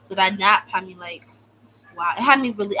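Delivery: normal talking voice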